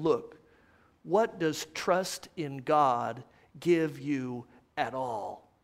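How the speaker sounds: low, slow, hushed taunt; quiet mockery. Unbelievers sneer at the suffering man's trust, quietly mocking. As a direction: taunt softly, drawing out each phrase. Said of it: "And they mock him".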